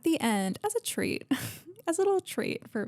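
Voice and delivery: high cute teasing voice; sing-song. Pretend. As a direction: tease it in a high cutesy lilt. Playful sing-song tease.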